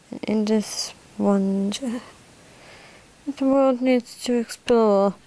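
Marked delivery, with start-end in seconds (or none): none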